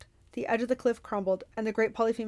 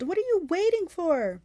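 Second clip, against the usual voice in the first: high, slow, impatient demand; 9 semitones higher, 4 dB louder than usual. Acted. Clarice urging Percy to finish it, impatient. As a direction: push him to act now.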